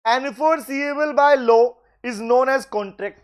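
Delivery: word by word, very slow; slow dictation. Shown as a demonstration. Teacher dictating the contract definition to students, very slow. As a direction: lay out each word so they can write it.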